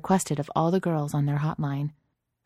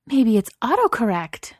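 louder, excited and high; high-pitched delight; 7 semitones higher, 5 dB louder than usual. A narrator's reading. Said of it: "her voice rising in high-pitched delight".

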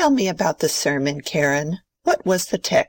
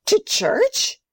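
high-pitched shocked gasp; gasp, then question it in rising disbelief; 10 semitones higher, much slower than usual. Acted. Grandmother hears of the red shoes, gasping.

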